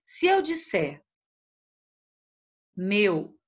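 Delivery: usual speaking voice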